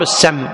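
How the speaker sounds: السم said "as-samm", with an a after the sīn; correct vowel a. Shown as a demonstration. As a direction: give السم an a and a closing double m. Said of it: "الصواب السم".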